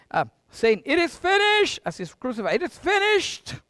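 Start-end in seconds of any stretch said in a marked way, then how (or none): 0.8-1.8 high-pitched dying cry of Jesus. Acted. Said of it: "cried out in a loud voice". 2.6-3.6 high, strained cry from the cross. Acted. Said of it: "cried out in a loud voice".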